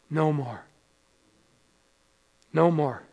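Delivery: low, soft and final; quiet solemn assurance; hushed, solemn. Real, not acted. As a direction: drop right down and let each phrase rest. Solemn promise after listing tears.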